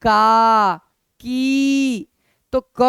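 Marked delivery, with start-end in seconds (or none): 0.0-0.8 का held long and loud; loud teacher demo of का; stretched syllable. Shown as a demonstration. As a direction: sound out का slowly and clearly. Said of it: "जैसे आप बोलिए".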